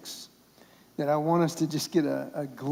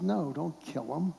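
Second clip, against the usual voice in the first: softer, slower, a lightly voiced gentle prophet; quietly steady, calming the eager king; calm refusal; 6 dB quieter than usual. Elijah calmly refuses the king.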